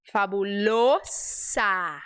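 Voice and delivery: "Fabulosa" slowly, closed o, crisp s; correct fabulosa. Shown as a demonstration. Teacher models the Spanish word for students. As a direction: say "Fabulosa" slowly with a tight o and hissed s. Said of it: "esse O você fecha e esse S é S".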